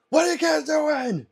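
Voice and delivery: loud, high shout through the wall; high shouted call to next door. Real, not acted. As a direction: yell over at the noisy neighbours. Calling out at noisy neighbours.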